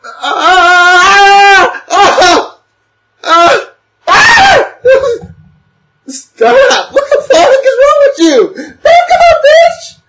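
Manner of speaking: high, loud panicked screams with laughter; terrified screaming. Real, not acted. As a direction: scream in real fright, half laughing. Screaming during VR horror scene.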